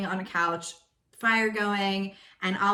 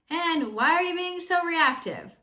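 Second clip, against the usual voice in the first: mock reactive; 9 semitones higher, 3 dB louder than usual. Mock reply to blaming.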